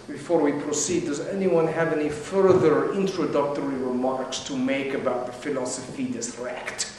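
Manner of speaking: low, soft, monotone lecturer; droning professor. Retold or imitated. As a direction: drone it like a bored professor. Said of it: "the droning voice of the professor".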